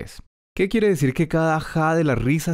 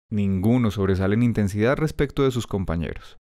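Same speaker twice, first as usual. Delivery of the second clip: ordinary talk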